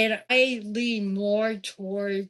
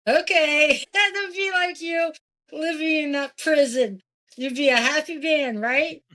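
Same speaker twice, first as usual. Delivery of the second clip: laughing, much higher and louder, teasing the host; excited teasing; 7 semitones higher, 4 dB louder than usual. Real, not acted. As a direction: burst out in delighted teasing. Teasing listener about prison, laughing.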